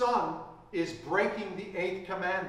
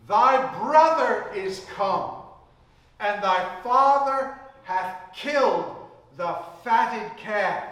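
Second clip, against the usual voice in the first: louder, slow proclamation of good news; announcing news; 4 semitones higher, 9 dB louder than usual. Retold or imitated. Servant telling the elder son, loud and proud. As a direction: call it out like glad tidings.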